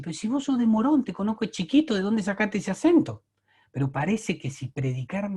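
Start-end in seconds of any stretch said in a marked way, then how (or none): 0.0-3.2 higher and louder, a mock-indignant challenge; incredulous retort. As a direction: call him out, half amused and disbelieving. Mocking a fake-accent preacher. (réplica incrédula, más aguda y fuerte)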